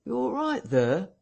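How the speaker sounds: high-pitched landlord calling in; calling through door. A narrator's reading. Landlord rapping on the parlour door, calling in with concern. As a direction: call a high, questioning check through a door. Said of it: "asked Hall, rapping".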